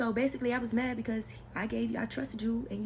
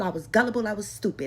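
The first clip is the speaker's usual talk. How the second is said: ordinary talk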